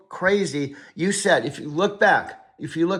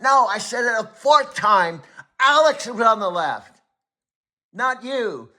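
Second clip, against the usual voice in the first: raised pitch, louder repeated no; exasperated no; 5 semitones higher, 4 dB louder than usual. Real, not acted. Coach loudly cutting off his AI assistant. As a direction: cut him off, loud and fed up. Correcting AI again.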